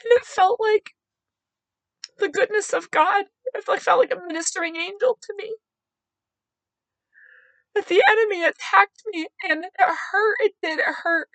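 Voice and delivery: real crying voice, pitched very high; tearful. Real, not acted. Woman telling of God's comfort after being hurt, tearful. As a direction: struggle through tears to get it out.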